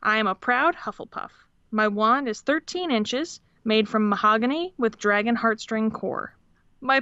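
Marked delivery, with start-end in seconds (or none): none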